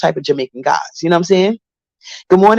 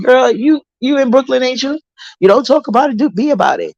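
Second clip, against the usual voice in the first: raised voice, daring her to back it up; retold challenge; 7 semitones higher than usual. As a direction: throw the challenge back at her, pitched high. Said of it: "I said".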